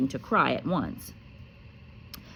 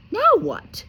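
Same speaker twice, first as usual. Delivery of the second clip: shrill furious aunt, high and loud; furious aunt snapping high; 7 dB louder than usual. A narrator's reading. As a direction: snap it at the boy. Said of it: "said Aunt Petunia, looking furiously".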